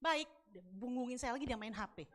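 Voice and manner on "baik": short, dismissive "baik"; imitating a brusque answer; retold curt reply. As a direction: toss off "baik" in one quick syllable. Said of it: "dia kasih tangan".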